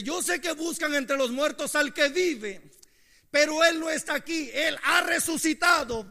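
loud, slow, heavy preaching; preaching at full force. Acted. Preacher thundering the angel's words to the congregation, loud and slow. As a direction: proclaim it to the whole church.

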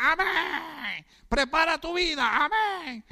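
high, eager answering amen; congregation's fervent amen. Retold or imitated. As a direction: echo it back like an old-time congregation. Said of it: "y los hermanos decían".

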